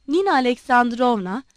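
usual speaking voice

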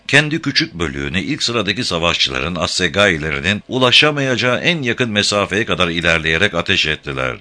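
usual speaking voice

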